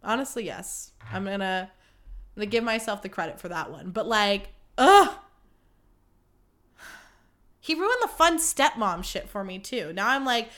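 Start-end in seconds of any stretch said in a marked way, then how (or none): 4.8-5.1 short, loud, high groan; exasperated groan. Real, not acted. Podcaster fed up with her breakup, exasperated groan. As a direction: groan it out in frustration.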